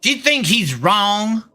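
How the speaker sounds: hammed-up Texas accent, lightly done; a Texan playing it up, drawling the vowels. Streamer performs a Texas accent for chat, hammed-up. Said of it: "You want me to do a Texas accent?".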